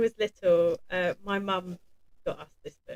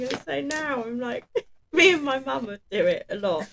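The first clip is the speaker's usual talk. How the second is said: normal talking voice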